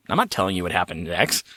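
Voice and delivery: ordinary delivery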